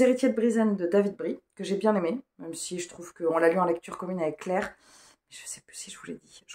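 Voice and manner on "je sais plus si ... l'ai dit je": hushed aside, barely voiced; library whisper. Real, not acted. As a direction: drop to a quick whisper. (chuchotement discret)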